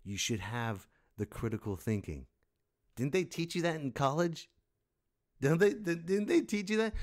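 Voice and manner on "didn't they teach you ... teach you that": high, loud disbelief; can't believe they never learned this; exasperated rising squeal; incredulous. Real, not acted. Incredulous rant at students.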